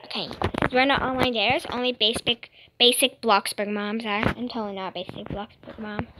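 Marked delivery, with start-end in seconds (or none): none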